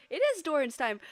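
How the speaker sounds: loud, high hype-man announcement; announcer hype. Pretend. Streamer hyping Doran's arc to chat, loud announcer voice. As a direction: boom it out like an intro announcer.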